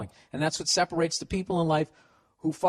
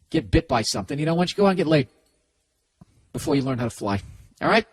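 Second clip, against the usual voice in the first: ordinary talk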